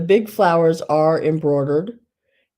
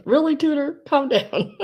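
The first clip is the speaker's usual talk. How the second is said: mildly higher teasing quote; quoting a friend; 10 semitones higher than usual. Retold or imitated. Sheila teasing Tudor about reallys, mildly higher quote.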